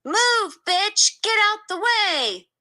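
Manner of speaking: high, loud mock scream at her. A narrator's reading. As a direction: yell it, shoving through the crowd. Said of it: "screamed at me".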